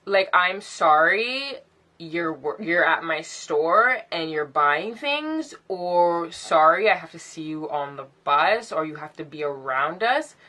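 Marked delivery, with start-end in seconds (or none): none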